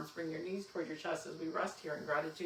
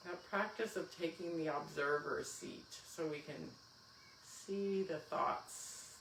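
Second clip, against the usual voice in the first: guided meditation; 5 dB quieter than usual. Acted. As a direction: guide them gently into stillness. Leads final relaxation.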